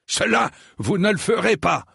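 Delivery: high, thundering refusal. A narrator's reading. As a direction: forbid it in a thundering voice. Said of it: "déclara sir Eustace d'une voix tonnante". (voix tonnante)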